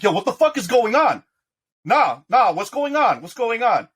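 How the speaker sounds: high, loud panicked shouting; panicked yell. Acted. Thug yelling at his crew as they grab him, high panic.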